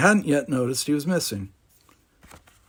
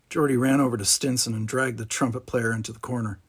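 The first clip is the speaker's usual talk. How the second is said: ordinary delivery, nothing marked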